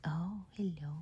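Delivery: ordinary delivery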